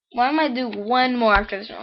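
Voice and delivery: ordinary talk, nothing marked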